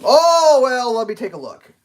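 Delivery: high, loud mock of the phone agent; mock customer service voice; breezy rep voice. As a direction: play the airy support guy. Retelling support agent on phone.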